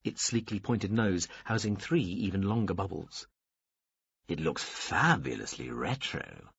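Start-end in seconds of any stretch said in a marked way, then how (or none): 4.3-6.5 lower, slow, drawled admiration; lightly voiced wealthy man. A narrator's reading. Veppers admiring the alien's ship, lightly voiced. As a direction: sound lazily amused.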